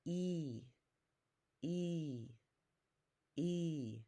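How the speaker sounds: "E" as the long vowel in English eat, drawn out; vowel sound demo. Shown as a demonstration. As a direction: say "E" like the start of eat, each one separate. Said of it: "the way you would say eat".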